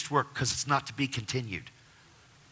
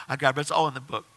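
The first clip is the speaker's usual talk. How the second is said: ordinary delivery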